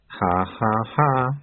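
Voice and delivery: spoken ha-ha, without real mirth; obedient fake laugh. A narrator's reading. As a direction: recite the laugh dutifully. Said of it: "said the other auditors obediently".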